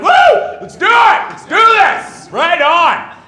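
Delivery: high, loud pumped-up whooping; hammed-up battle enthusiasm; fired-up crowd. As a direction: yell like troops raring to fight. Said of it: "He gets everyone fired up".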